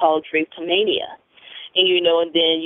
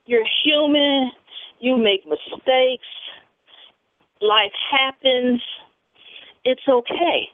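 motivational pep talk; 7 semitones higher than usual. Acted.

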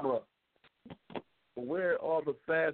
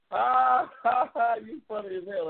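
laughing; 7 semitones higher, 3 dB louder than usual. Real, not acted. Laughing at viewer question.

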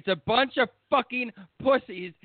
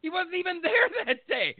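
exasperated laugh; 10 semitones higher than usual. Real, not acted.

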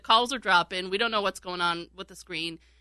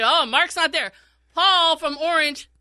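mock exasperated host, higher and louder; hammed-up impatient host dropping a caller; mimicking the host; 7 semitones higher, 6 dB louder than usual. Radio host annoyed his calls keep dropping, mock exasperated.

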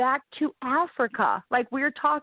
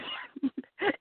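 soft laugh; 4 semitones higher, 7 dB quieter than usual. Real, not acted. Laughs after her story.

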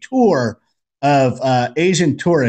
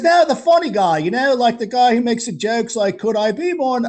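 Australian guy; 9 semitones higher than usual. Retold or imitated. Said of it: "He goes".

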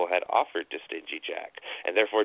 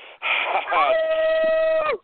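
high wild cackling laugh; howling laughter; 5 dB louder than usual. A narrator's reading. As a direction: howl with mad laughter. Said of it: "Jack howled with laughter".

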